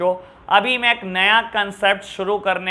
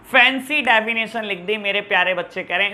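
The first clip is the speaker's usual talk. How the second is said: ordinary delivery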